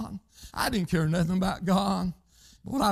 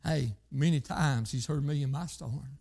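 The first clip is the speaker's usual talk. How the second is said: ordinary talk, nothing marked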